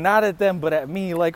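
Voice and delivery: ordinary delivery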